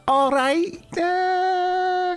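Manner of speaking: very high-pitched Scouse greeting; Scouse accent. Acted. Game master joking as Lady Taunt, high Scouse accent. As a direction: stretch it out in a high, comic Scouse voice. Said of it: "Maybe Lady Taunt speaks that".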